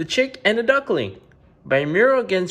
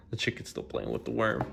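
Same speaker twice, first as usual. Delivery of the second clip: hushed whisper with a small laugh; whispered aside; 5 semitones lower, 12 dB quieter than usual. Real, not acted. Reader whispering an aside to the child mid-story.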